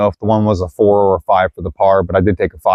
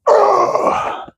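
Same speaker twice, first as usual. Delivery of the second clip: high frustrated groan. Real, not acted. Errant throw into woods.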